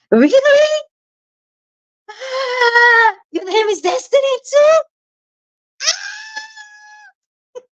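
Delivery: overhyped shriek of joy; excited squeal. Real, not acted. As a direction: squeal with delight at the shared name. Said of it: "I just scream".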